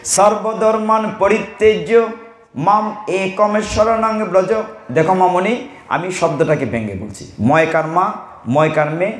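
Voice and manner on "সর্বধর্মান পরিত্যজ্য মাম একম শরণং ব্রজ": slow, measured chanting of the verse; Sanskrit sloka recitation. Shown as a demonstration. Preacher reciting the Gita verse to devotees, slow chant. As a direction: intone the sloka slowly and solemnly. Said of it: "ভগবান বলছেন কি?".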